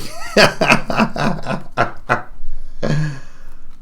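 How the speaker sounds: long, soft, breath-heavy laugh; helpless quiet giggling; amused laugh. Real, not acted. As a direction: laugh until it trails away quietly. Amused at own drawing.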